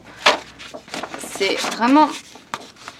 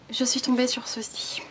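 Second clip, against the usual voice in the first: whisper; 2 semitones higher, 6 dB quieter than usual. Real, not acted. Confessing another book purchase.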